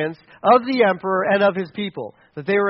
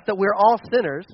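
normal talking voice

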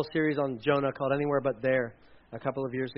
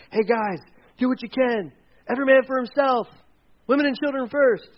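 mock panic; 7 semitones higher than usual. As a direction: play a frantic captain for laughs.

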